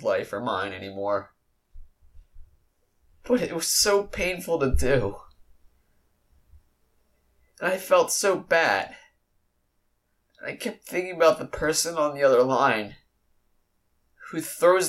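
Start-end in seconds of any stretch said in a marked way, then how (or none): 3.2-12.1 holding back sobs while talking; tearful. Real, not acted. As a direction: voice rising and thick with tears. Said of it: "I'm crying".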